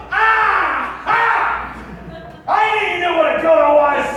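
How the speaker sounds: high, shouted panic; panicked dad. Retold or imitated. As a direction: screech it defensively, very high.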